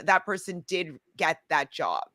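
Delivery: ordinary delivery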